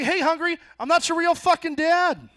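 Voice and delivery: high, loud, angry dad shouting; dad screaming his kid out of the house; furious dad yell. Retold or imitated. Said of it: "I'll be like".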